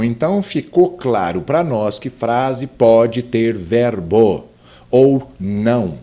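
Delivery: usual speaking voice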